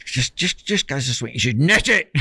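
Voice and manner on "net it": retold sharp command, higher pitched; mock urgent call, pitched up; urgent order. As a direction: call it out like the coach ordering an angler.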